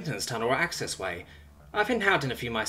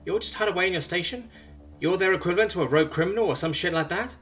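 higher, breezy questioning tone; casual interrogation; 8 semitones higher than usual. Acted. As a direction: probe him coolly, unbothered. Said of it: "Simone interrogates".

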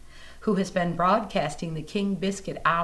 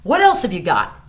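much louder, higher urging voice; eager friend; 8 semitones higher, 8 dB louder than usual. Retold or imitated. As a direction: push her to grab her guitar. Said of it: "he goes".

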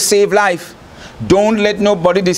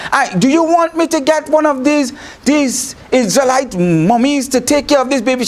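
put-on higher, eager girlish offer; Moses' sister offering; 6 semitones higher than usual. Moses' sister running from the bushes to the princess, higher and eager. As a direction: pitch it up as the eager little sister. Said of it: "ran out from the bushes and said".

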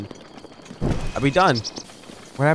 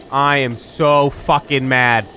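slow, louder, every word hammered; emphatic anger; 4 dB louder, slower than usual. Real, not acted. Player fed up with the game, slow emphatic anger. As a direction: spell out the fury word by word.

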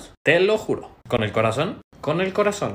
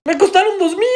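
very high, loud protest; outraged shout; 13 semitones higher, 6 dB louder than usual. Acted. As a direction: explode in comic indignation. Acted outrage at low offer.

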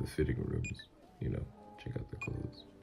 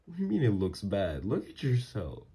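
higher, insistent reassurance to a partner; incredulous protest; 4 semitones higher, 3 dB louder than usual. Acted. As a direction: protest it as an admiring lover. Protests partner's doubt about looks.

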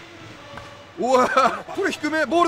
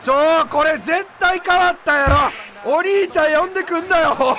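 loud protest; 4 semitones higher, 5 dB louder than usual. Real, not acted. Player accusing opponent of calling in big brother, loud protest. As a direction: cry foul at the opponent, loudly.

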